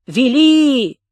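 loud clipped repeat; barked confirmation. A narrator's reading. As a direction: slam the word Вели down. Accusing repeat.

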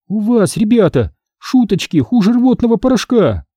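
high-pitched taunting jibe; snide. A narrator's reading. Hannah to the joking boys, snide. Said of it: "съязвила Ханна".

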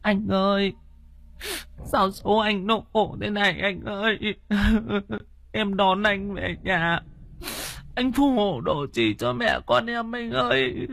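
high wailing widow's lament; keening through tears; tearful lamenting. Acted. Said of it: "Thím Nhàn ở bên kia thì than vãn".

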